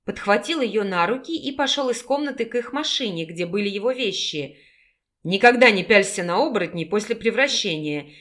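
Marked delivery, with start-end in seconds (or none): none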